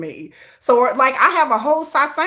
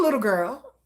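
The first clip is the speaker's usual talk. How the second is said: dropped low and soft, dismissive; condescending low jab; taunting little girl; 6 semitones lower, 5 dB quieter than usual. Acted. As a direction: sneer it down at her. Taunting a hater.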